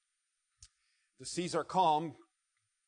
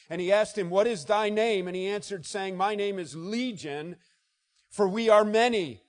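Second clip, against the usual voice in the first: loud, high, rising scripture read; preacher reading the madman's cry at full force; preaching scripture; 5 semitones higher, 11 dB louder than usual. Acted. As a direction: thunder the verses out.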